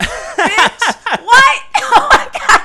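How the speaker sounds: high, loud shriek through laughter; laughing scream. Retold or imitated. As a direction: shriek it out while cracking up. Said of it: "I screamed".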